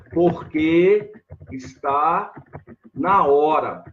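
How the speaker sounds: "Porque está na hora" spoken slowly, each word laid out; slow model answer. Shown as a demonstration. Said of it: "A resposta".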